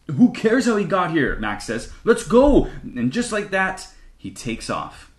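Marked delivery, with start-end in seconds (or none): 0.1-1.4 high, louder impatient burst. A narrator's reading. Mac brushes off Davery's question, impatient and high. 2.0-2.7 high, loud rallying cry; eager shout. A narrator's reading. Mac takes off after his friend, eager shout. Impatient boy dashes off.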